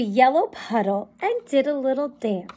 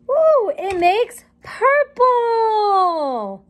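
delighted surprise; 7 semitones higher, much slower than usual. Acted. Colours mixed into purple.

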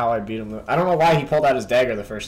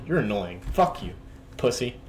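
ordinary talk

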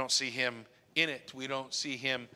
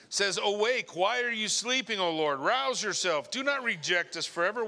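higher, fervent scripture lament; preacher reading a lament with urgency; impassioned psalm reading; 5 semitones higher than usual. Acted. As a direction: cry the psalm out to God. Reads lament psalm aloud.

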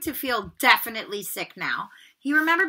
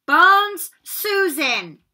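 higher, louder, slow name call; 8 semitones higher, 10 dB louder, much slower than usual. Acted. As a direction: draw the name out to the hall. McGonagall calls names aloud.

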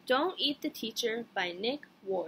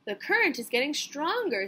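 higher singsong call; lightly singsong; 4 semitones higher, 5 dB louder than usual. A narrator's reading. Teacher at the piano urging dancers, lightly singsong. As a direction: lightly sing it out over music. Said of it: "sang the teacher".

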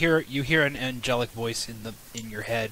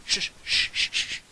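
shushing. Real, not acted. Hushing the table.

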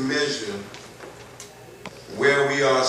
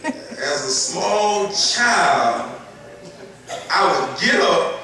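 animated preaching; 5 semitones higher, 5 dB louder than usual. Acted. Animated childhood story.

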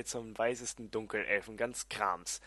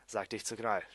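ordinary delivery, nothing marked

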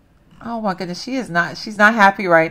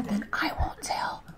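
slow, very soft secret whisper; conspiratorial whisper; 14 dB quieter, slower than usual. Pretend. Streamer making a pact with viewers, slow whisper. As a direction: whisper it slowly, sealing a pact.

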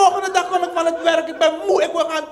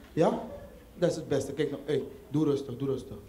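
put-on deep, soft crooning boyfriend; smooth low lover voice; 13 semitones lower, 7 dB quieter than usual. Man soothing his girlfriend while dumping her, deep and soft. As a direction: lay on the smooth bass charm to break up gently.

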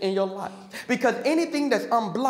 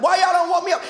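sudden loud, higher outburst of woken anger; mock angry Jesus; 8 semitones higher, 6 dB louder, faster than usual. Jesus woken on the boat, angry at the disciples, higher and louder. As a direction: bark it like you were shaken awake. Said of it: "Jesus woke up mad".

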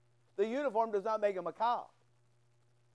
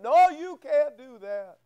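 stern mock refusal; 4 semitones higher, 10 dB louder, slower than usual. Pastor retells officer refusing again.

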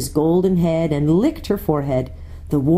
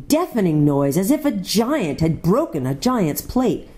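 ordinary delivery

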